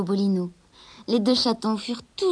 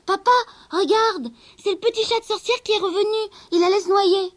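put-on much higher, loud eager child; excited children; 11 semitones higher, 7 dB louder than usual. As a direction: run in calling to papa. Children call to their father.

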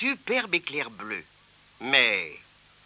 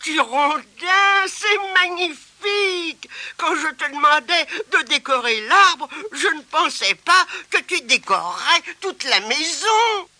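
high, loud, delighted exclamation; amazed; 12 semitones higher, 4 dB louder than usual. Acted. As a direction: cry out in wonder. Grandmother amazed at house.

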